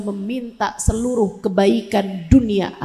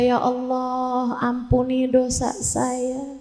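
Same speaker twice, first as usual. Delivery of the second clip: humble prayer; 4 semitones higher than usual. Retold or imitated. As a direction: beg God for forgiveness in a hushed, tender plea. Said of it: "kemudian berkata".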